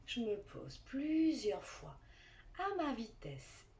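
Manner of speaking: calm guided relaxation voice; guided meditation. Acted.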